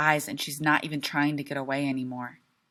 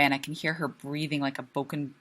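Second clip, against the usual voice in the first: usual speaking voice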